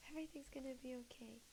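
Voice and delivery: fast whispered self-reassurance; hushed quick reassurance to herself. Real, not acted. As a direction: whisper it quickly to calm yourself. Calming herself.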